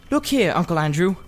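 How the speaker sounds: lightly voiced young boy; Diggory. A narrator's reading. Diggory wanting to leave the house, lightly voiced boy.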